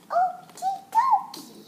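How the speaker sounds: high, slow singsong okie dokie; chirpy okie dokie. Acted. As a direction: sing it out brightly and drawn out. Owner replies to dog.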